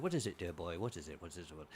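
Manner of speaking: put-on low, soft old-actor murmur; Sir Michael Hordern. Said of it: "And he said".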